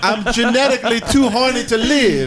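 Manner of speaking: high, laughing fake Italian; begging Italian voice. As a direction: whine it as a horny Italian.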